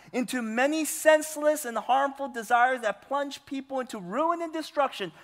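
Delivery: loud, high-pitched sermon delivery; forceful preaching. Acted. Pastor preaching hard to his church, loud and high. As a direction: preach it out to the whole congregation.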